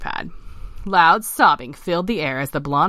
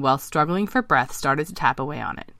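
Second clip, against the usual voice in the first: usual speaking voice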